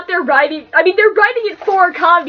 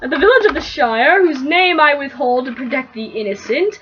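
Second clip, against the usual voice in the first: reading the fanfic aloud to her roommate, lightly narrated; easy storyteller read, a touch of mock grandeur; story reading; 2 semitones lower than usual. Reading a parody fanfic to a roommate, mild storybook voice.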